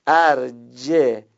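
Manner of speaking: teacher firmly sounds out ارجهی; slow model reading. Shown as a demonstration. Concluding which reading it is, slowly. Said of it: "پس".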